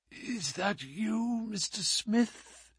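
soft, slow faint whisper. A narrator's reading. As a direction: breathe it weakly from the bed. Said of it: "Holmes whispered".

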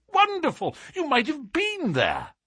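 high-pitched, amazed exclamation; admiring cry. A narrator's reading. Colonel praises Holmes's reconstruction of the night, high cry of wonder. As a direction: gush it high in astonished praise. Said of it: "cried the Colonel".

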